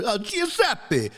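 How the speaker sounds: high, slow mock Italian pizza-shop accent; Italian pizza guy. Joking with friends, mock Italian pizza-shop owner accent. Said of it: "pizza shop owner".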